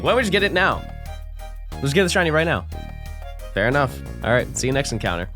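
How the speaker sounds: ordinary talk, nothing marked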